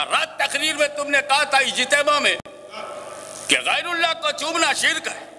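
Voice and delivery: high, rising preaching; preaching at full force. Acted. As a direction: build to the crowd. (ऊँची, चढ़ती तक़रीर)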